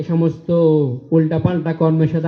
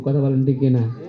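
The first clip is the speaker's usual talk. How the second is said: normal talking voice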